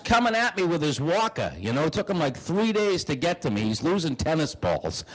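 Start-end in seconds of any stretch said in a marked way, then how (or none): none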